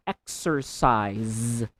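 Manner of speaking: exercise said slowly, each syllable clear. Shown as a demonstration. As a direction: draw out exercise carefully. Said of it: "So you should say".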